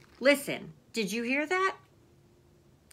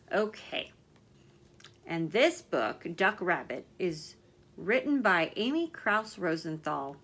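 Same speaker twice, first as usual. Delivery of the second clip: ordinary delivery, nothing marked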